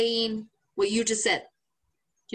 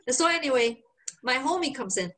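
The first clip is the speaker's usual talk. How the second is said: ordinary talk, nothing marked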